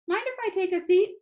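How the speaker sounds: lightly voiced grandfather offering to sit; Grandpa asking. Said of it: "Grandpa asked".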